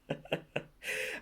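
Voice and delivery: short, breathy burst of laughter; incredulous laugh. Acted. Told it was okay if he failed, incredulous laugh. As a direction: laugh in disbelief. Amused at being allowed to fail.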